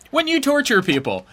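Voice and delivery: loud, hammed-up reporter question; mock interviewer. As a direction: pretend chirpy interview, pushed loud. Mock interview of drug lord.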